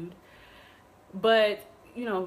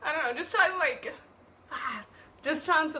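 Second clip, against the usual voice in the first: higher voice, tearful laughing; emotional; 6 semitones higher than usual. Real, not acted. Guest trying to explain through tears, emotional. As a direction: laugh through real tears, struggling to continue. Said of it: "I'm so emotional right now".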